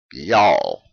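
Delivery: "Y'all" stretched long and low in pitch, falling at the end; Southern "Y'all", long and falling; drawn-out y'all. Shown as a demonstration. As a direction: draw out "Y'all" slow and low in a Southern drawl. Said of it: "You gotta kinda draw it out there".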